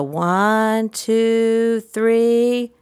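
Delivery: ONE, TWO, THREE held long and high; suspenseful count. Shown as a demonstration. Therapist about to blow bubbles, suspenseful sing-song count. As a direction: sustain each number, leaving THREE hanging. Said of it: "I have a pregnant pause".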